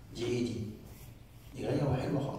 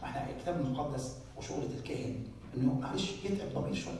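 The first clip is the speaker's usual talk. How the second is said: ordinary delivery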